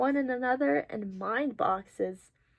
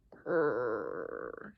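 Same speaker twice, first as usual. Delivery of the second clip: low, drawn-out rolling growl; imitated stomach growl; 13 semitones lower, 4 dB quieter than usual. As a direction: growl "grrr" like an empty tummy. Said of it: "Sometimes mine says".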